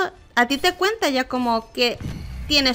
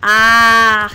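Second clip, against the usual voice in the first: frustrated ah; 8 dB louder than usual. Real, not acted. Another frustrated outburst.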